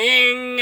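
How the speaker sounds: imitated dirt bike engine hum; droning "ing" of a dirt bike. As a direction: make a humming motor noise like a dirt bike. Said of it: "like a dirt bike humming noise".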